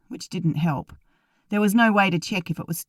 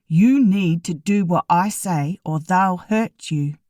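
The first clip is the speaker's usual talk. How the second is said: slow, louder, each word spelled out; every word emphasized; 4 dB louder, slower than usual. A narrator's reading. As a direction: lean hard on every single word. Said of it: "his mouth emphasized every word".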